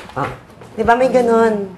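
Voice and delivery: ordinary talk, nothing marked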